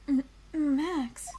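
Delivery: usual speaking voice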